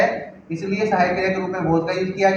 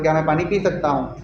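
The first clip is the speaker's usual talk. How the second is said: ordinary talk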